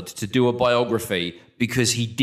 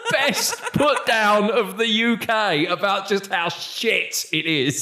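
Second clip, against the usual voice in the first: higher and slower, laughter running through it; laughing; 9 semitones higher, slower than usual. Real, not acted. Host sums up the best UK put-down, still laughing. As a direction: talk through the tail of a laugh.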